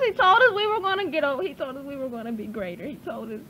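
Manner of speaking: fast, sinking to a hush; fading confession. Real, not acted. Demon confessing to the minister, fading to a hush. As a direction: let it drain away.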